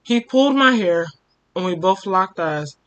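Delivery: usual speaking voice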